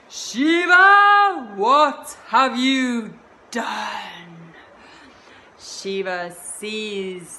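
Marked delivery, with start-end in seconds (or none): 0.1-4.5 high, loud, very slow furious cry; raging Kali. Acted. Kali confronting Shiva over her dead son, raging and slow. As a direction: unleash Kali's wrath. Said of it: "with rage in her eyes".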